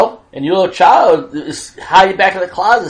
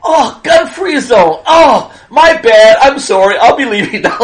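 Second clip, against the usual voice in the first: mock-polite burglar backing out; laughing, lightly voiced apologetic robber; sheepish robber; 6 semitones higher, 5 dB louder than usual.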